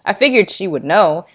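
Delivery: ordinary delivery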